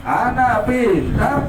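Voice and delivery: shouted call. Real, not acted. Host shouting the call to the kids, full voice. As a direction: shout it out to wake them up.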